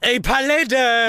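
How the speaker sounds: words burped out; burping the words. Real, not acted. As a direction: belch the words out. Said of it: "Ich war das nicht. Wie soll ich denn gleichzeitig rülpsen und reden?". (gerülpste Worte)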